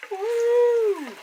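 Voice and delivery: high, loud falsetto woo; excited whoop. Real, not acted. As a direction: cheer with delight. Overjoyed at the smell.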